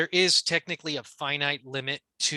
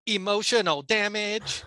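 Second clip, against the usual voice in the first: slow, higher-pitched mock Chinese accent; comedian's catchphrase, put-on Chinese accent; 6 semitones higher than usual. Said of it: "just the way he says it".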